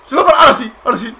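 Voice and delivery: high, loud cheer with a laugh; excited shout. Real, not acted. Gamer cheering his wrestler's reversal, loud and excited. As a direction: burst out celebrating the kick-out.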